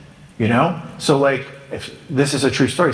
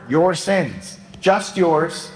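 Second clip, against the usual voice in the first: usual speaking voice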